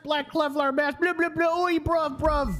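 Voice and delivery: high-pitched mocking British caller, blah blah gibberish; squeaky parody of a thick British caller; mock British accent. Mocking the caller to his partner in a high British accent. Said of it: "He just started saying".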